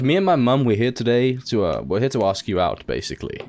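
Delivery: usual speaking voice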